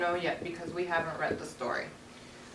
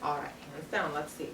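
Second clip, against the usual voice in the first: ordinary talk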